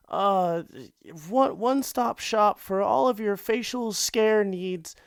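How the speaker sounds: high, shaky-voiced pitchman falling apart; nervous intern ad read. Intern begging listeners to buy acne cream, high and panicky. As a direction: plead through a botched commercial. Acted Richard Scary ad skit.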